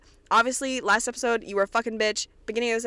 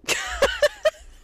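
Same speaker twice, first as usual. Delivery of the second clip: high burst of laughter; 5 dB louder than usual. Real, not acted. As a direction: cackle at the joke. Laugh after joke.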